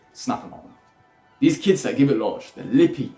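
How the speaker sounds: normal talking voice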